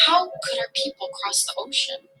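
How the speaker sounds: put-on a high, fearful child's plea; a scared boy asking the goddess; frightened boy. A narrator's reading. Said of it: "although he was very frightened".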